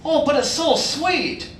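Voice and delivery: high, slow, drawn-out customer voice; slow mock customer query; mimicked customer. Imitates suspicious customer.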